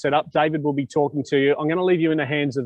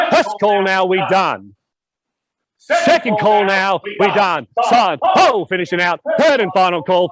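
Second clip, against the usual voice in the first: loud, drawn-out final calls, higher; auctioneer final call; 4 semitones higher, 5 dB louder, slower than usual. Acted. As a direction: build to the hammer, louder each call. Final calls before the hammer.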